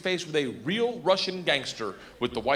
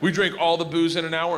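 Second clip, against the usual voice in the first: usual speaking voice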